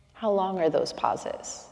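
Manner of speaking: ordinary delivery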